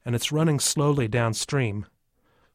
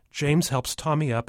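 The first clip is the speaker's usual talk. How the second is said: usual speaking voice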